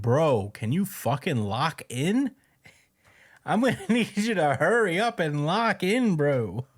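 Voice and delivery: mock yelling. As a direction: play at hollering at a slacking friend. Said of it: "he's, like, yelling at him".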